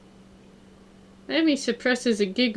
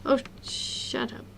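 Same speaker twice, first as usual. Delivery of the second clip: slow, hushed grumble at background noise; annoyed aside; 2 semitones higher, 8 dB quieter than usual. Real, not acted. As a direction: say it under your breath, drawn out.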